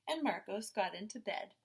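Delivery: usual speaking voice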